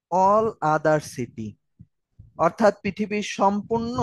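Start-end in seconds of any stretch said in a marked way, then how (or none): 0.1-1.5 slow, spelled out. Shown as a demonstration. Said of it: "আরেকটা হচ্ছে".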